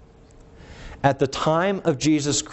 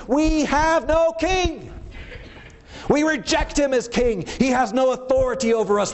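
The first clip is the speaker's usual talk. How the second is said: mocking defiant quote; 11 semitones higher than usual. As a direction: parody their defiance, pitched way up. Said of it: "What they just said is".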